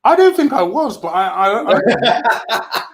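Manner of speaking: stammering through giggles; giggling denial. Real, not acted. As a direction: laugh helplessly while protesting. Laughing after being called drunk.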